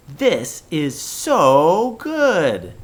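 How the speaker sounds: slow, loud, delighted; excited. Shown as a demonstration. Teacher gushing to show an exclamation, excited. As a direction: savour it with big joy. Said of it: "you can hear how excited I am".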